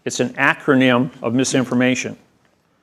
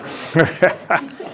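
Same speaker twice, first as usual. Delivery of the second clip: breathy laugh; 3 semitones higher, 5 dB quieter than usual. Real, not acted. Laughing at own story.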